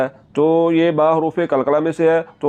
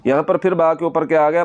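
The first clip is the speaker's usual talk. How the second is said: usual speaking voice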